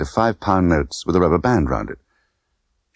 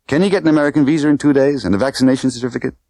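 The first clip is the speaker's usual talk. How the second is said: brisk question; 8 semitones higher, faster than usual. Acted. Tiffany's rapid questions.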